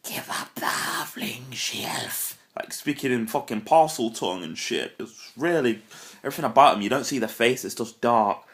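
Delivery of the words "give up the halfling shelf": slow, soft, whispered serpent hiss; Nagini-like hiss. Retold or imitated. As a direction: whisper it slowly like a creeping snake. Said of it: "they kinda speak like Nagini".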